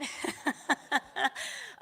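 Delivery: loud, high peal of laughter. Real, not acted. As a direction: burst into real laughter. Laughs off her emotional moment.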